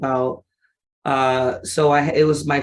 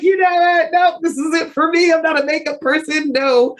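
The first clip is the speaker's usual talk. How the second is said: exasperated self quote; 13 semitones higher than usual. Retold or imitated. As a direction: throw up your hands and reject it, high and animated. Retelling her reaction to makeup.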